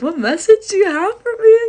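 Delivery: high, loud mock-eager squeal; eager question. Storyteller asking a stranger for his message, high eager squeal. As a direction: ask it way up high, hungry for the answer.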